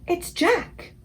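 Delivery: high frightened cry; bellowed. A narrator's reading. Giant crying out in his nightmare, high frightened cry. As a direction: cry out the name in fear. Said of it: "bellowed the flesh lump eater".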